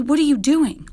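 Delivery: high, loud yelled question. A narrator's reading. Brother catches sister at his diorama, high outraged yell. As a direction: yell it in outrage, pitch leaping up. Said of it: "yelled Billy".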